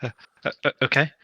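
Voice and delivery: high, panicky stammering trooper; nervous stammer. Pretend. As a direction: stutter out the nerves in character. Twitchy trooper panics at orders.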